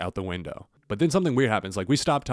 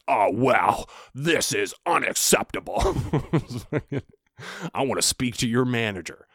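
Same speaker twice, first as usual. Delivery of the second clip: Karen voice; 4 semitones higher than usual. Retold or imitated. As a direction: play the outraged entitled customer. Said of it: "she was like".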